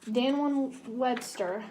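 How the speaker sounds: usual speaking voice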